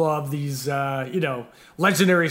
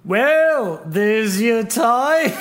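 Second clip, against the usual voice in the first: drawn-out, higher attempt at a Liverpool accent; badly faked Scouse, slow and raised; 5 semitones higher, 4 dB louder, much slower than usual. Host quoting [name]'s reply in a shaky-attempt Beatles accent. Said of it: "me putting on a Beatles accent".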